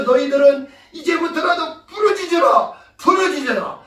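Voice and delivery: urgent exhortation. Acted. Preacher relaying Jesus's command to cry out, urgent shout.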